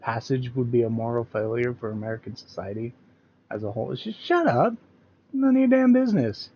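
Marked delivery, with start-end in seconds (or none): 3.9-6.5 hammed-up high, loud indignant yell; over-the-top sarcastic tantrum; mock outrage. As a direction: play a shrill offended zealot snapping back. Mocking angry MAGA reaction.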